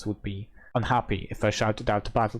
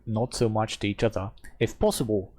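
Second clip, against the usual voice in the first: ordinary delivery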